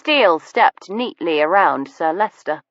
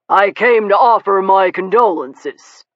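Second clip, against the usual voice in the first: touch of reproof; 3 semitones higher, 4 dB louder than usual. A narrator's reading. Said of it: "with a touch of reproof".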